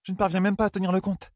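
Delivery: pitched well up, held level; high character voice. A narrator's reading. A character admits losing count to Nineveh, in a high voice. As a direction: put on a high voice for dialogue.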